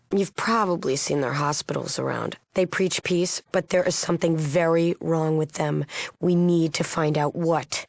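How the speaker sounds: usual speaking voice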